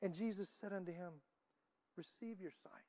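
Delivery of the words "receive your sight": gentle pronouncement. Retold or imitated. Jesus speaking gently.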